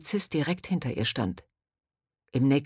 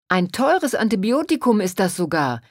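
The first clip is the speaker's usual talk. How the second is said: loud, higher-pitched outcry; indignant shout; 4 semitones higher, 6 dB louder than usual. A narrator's reading. Robbed pharmacist shouting to the crowd about her stolen medicine. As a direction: cry it out as the wronged party. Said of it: "schrie die bestohlene Frau".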